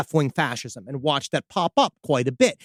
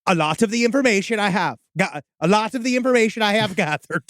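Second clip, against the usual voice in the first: Norwegian attempt slipping into Irish; accidental Irish accent; 3 semitones higher than usual. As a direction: try Norwegian, land on Irish brogue. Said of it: "I tried to do Norwegian, and it went straight to Irish".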